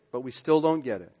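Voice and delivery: normal talking voice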